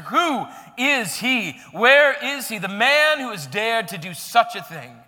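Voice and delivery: slow, loud demanding king; angry king. Acted. King demanding who dared threaten his queen, loud outrage. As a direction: roar the question like an outraged king.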